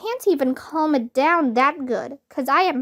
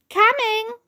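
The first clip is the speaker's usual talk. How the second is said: shouted call; 9 semitones higher than usual. A narrator's reading. Girl answering the teacher's whistle, shouted call. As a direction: call out across the yard. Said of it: "I shouted".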